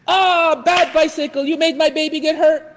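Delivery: high, indignant mock scolding; hammed-up babying outrage; scolding parent. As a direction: overprotective parent telling off a bicycle. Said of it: "they start spanking that".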